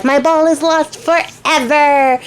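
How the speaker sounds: hammed-up, drawn-out wail; mock crying. Princess crying over her lost ball, mock wail. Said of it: "as she's crying".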